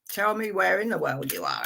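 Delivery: ordinary delivery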